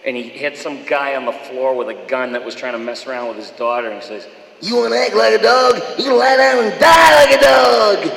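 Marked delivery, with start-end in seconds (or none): 4.6-8.1 bad-guy movie bellow, loud and high. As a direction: roar a threat at a man on the floor. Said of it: "he had a funny kind of voice".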